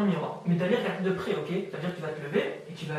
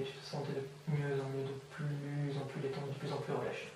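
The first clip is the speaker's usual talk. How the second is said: soft, low-energy soothing hypnosis voice; hypnotic induction; 3 semitones lower, 6 dB quieter than usual. Real, not acted. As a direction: ease them quietly into drifting relaxation. (voix douce et apaisante d'hypnotiseur)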